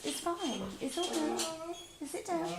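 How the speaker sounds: high, soft talk to a dog; soothing pet talk. Real, not acted. Host calms her restless dog, high and soft.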